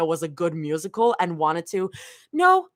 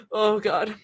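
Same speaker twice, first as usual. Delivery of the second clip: usual speaking voice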